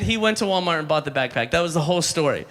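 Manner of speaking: ordinary delivery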